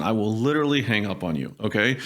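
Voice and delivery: ordinary talk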